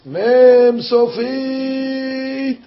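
very slow, high called-out letter; drawn-out cry. A narrator's reading. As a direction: stretch it out like an ecstatic chant. Climax of letter chant.

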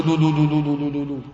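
quick rippling string of short notes; imitated rapid lu-lu teruah. Shown as a demonstration. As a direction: sound out a fast, even horn trill. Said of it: "Or some do lu".

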